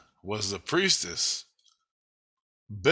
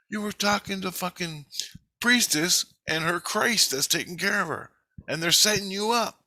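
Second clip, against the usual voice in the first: put-on higher girl's voice, urgent; daughter explaining; 7 semitones higher than usual. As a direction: voice the daughter, higher, pressing the warning. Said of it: "this is my little girl telling me this".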